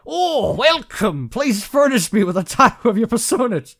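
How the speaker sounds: loud, high hammed-up character voice; Padre. Game player voicing a CEO's greeting, hammed-up Padre impression. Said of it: "I'll make him sound like Padre".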